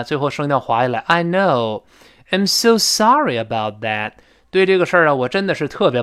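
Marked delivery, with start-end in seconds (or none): 1.1-4.1 apologetic, "know" long and sliding down; drawn-out know. Shown as a demonstration. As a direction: stretch "know", lean on "so sorry". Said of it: "拖长音儿啊，最后声调滑下来". ("know"拖长下滑，语气抱歉)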